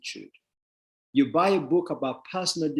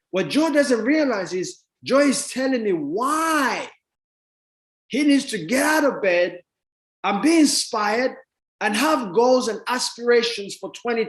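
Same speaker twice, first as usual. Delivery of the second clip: louder, higher, preaching the point; fired-up emphasis; 7 semitones higher, 4 dB louder than usual. Real, not acted. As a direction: hammer it home to the crowd. Speaker driving his point home.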